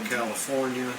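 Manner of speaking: ordinary talk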